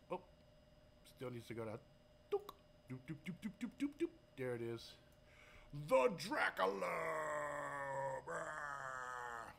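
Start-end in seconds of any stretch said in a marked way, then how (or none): 2.3-4.1 higher sing-song doops; high mock scroll noises. Scrolling sound effect. 5.9-9.5 mock dragon roar. Presenting the dragon again.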